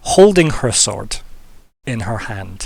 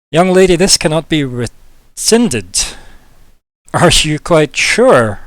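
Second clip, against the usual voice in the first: put-on slow, grave, louder and higher old counselor; stern counselor; 5 semitones higher, 6 dB louder, slower than usual. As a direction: weigh every word like a solemn court official. Counselor warns Vermi before the court.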